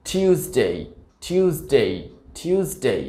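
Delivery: British "tyoo" opening টিউসডে; correct British pronunciation. Shown as a demonstration. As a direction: say টিউসডে with a y glide after t. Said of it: "এটি সঠিক উচ্চারণটা হবে".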